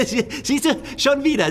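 high laughing through the words. Acted. Laughs at captive's joke.

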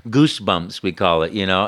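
usual speaking voice

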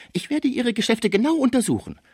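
very high-pitched counter-threat; threatening Frisier. A narrator's reading. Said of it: "Man trennte sich unter Drohungen". (drohend, mit sehr hoher Stimme)

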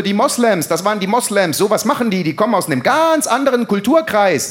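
high-pitched, agitated blame; ranting accusation. Acted. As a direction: rattle off accusations in mock outrage. Acted ranting blame of a group. (aufgebracht hohes Schimpfen)

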